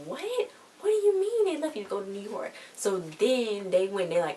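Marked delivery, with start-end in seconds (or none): 0.1-2.5 shocked disbelief. Retold or imitated. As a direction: squeak out the parents' stunned outrage. Parents shocked by the news.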